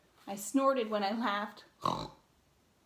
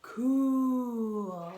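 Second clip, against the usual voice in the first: drawn-out cool; 7 dB louder than usual. A narrator's reading. Cool beans reacting, refrain.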